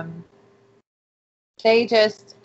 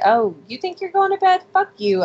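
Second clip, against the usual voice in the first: "Oh" shoots up high, taunting; mock taunt; 10 semitones higher, 3 dB louder than usual. Taunting the man who tried to go to bed, sarcastic. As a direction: needle him with a sarcastic high start. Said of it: "'cause I was like".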